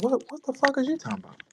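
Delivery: high, fast, stammered disbelief; baffled disbelief. Retold or imitated. Comic telling of his reply at the mall, baffled and high. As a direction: blurt it out in a high, rushed voice.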